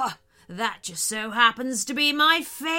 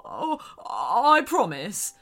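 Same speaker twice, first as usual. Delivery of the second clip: slow, sniffly vow; tearful promise; 3 dB louder, slower than usual. Acted. Shark vowing to be nicer, tearful. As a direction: promise through the tears.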